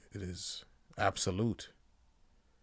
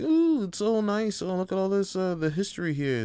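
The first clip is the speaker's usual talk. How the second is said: mock tourist; 10 semitones higher, 4 dB louder than usual. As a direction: coo over the exhibits like an awed sightseer. Mocking tourists admiring history.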